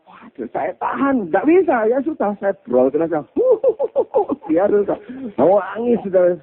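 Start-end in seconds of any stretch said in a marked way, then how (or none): 3.3-4.5 laughing. Real, not acted.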